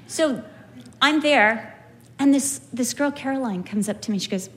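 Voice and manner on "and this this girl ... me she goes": normal talking voice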